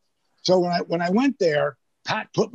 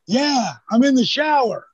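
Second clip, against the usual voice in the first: calling back; 7 semitones higher, 4 dB louder than usual. Retold or imitated. Rock answers from the shower.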